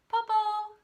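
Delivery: high, loud call into silence; shouting. A narrator's reading. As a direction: call out for the wolf, get no answer. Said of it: "Tao shouted".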